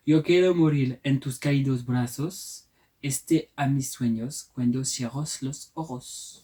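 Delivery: poem read in Spanish with a French accent. Real, not acted. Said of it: "mon accent est très mauvais".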